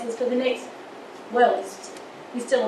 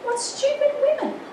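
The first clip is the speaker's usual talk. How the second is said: scornful mock; 13 semitones higher than usual. Mocking the women who refuse help.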